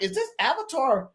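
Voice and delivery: high, soft disbelieving question; flabbergasted. Retold or imitated. Host retells the reaction to the avatar, flabbergasted. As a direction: pitch it up in stunned disbelief, voice kept light. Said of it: "I was flabbergasted. I'm like".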